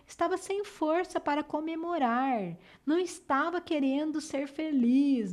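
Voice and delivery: dejected. Shown as a demonstration. Teacher explaining to pupils what a downcast person is, subdued and soft. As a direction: let the strength drain out of the voice. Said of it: "e é abatido. Uma pessoa abatida".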